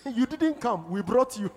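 ordinary talk, nothing marked

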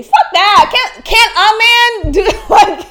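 loud, high, laughing protest; exasperated outburst. Real, not acted. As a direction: burst out in amused exasperation. Exasperated outburst about dating advice.

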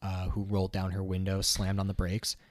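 ordinary talk, nothing marked

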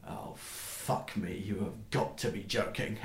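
weary dismay. Acted. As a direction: sink into it with dread. Dwarf hears a dreaded acquaintance.

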